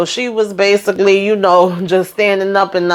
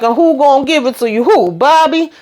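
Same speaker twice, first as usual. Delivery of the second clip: high-pitched derisive questioning; mocking challenge; 8 semitones higher than usual. Husband taunts Lily about who would provide, mocking challenge.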